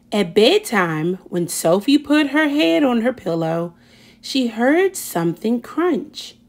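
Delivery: ordinary delivery, nothing marked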